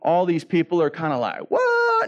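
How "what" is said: very high, loud, drawn-out squeal; mock astonishment. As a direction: imitate a flabbergasted crowd in falsetto. Crowd stunned by fire from heaven.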